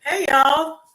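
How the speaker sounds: bright-pitched, loud Southern greeting; Southern accent. Real, not acted. Guest greeting the hosts as she is brought in, Southern accent. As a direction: call out hello in a Southern accent. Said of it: "I love that Southern accent".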